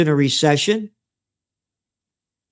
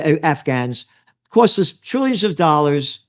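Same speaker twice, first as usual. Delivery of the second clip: usual speaking voice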